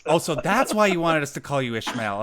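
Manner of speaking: loud, high teasing realization; loud, tickled jab at a friend; amused teasing. Real, not acted. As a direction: call it out over the laughter, amused. Host joking back amid laughter.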